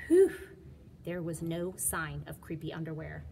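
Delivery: plain reading voice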